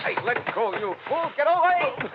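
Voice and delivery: high strained yell while grappling; struggling yell. Acted. Man wrestling with Dollar at the window, high strained yell. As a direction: shove him off as you shout.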